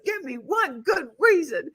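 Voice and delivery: high, challenging retold demand; mock exasperation. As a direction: demand it in a high, put-on huff. Retold argument carrying a mattress upstairs.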